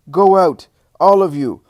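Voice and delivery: loud, high angry order; imposing, furious outburst; wrathful command. A narrator's reading. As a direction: throw them out at full voice. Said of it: "She is imposing in her wrath".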